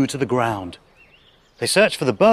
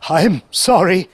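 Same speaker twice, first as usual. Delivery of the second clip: a touch fearful, pitched up; mild spluttering; 5 semitones higher, 4 dB louder than usual. A narrator's reading. Dragon apologizes to the beast, spluttering mildly. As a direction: splutter an apology, slightly rattled. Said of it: "he spluttered".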